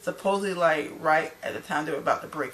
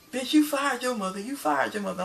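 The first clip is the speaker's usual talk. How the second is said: higher-pitched mock outrage; retold angry insult; 7 semitones higher than usual. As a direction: imitate a heated comeback, lightly acted. Retold insult during a fight.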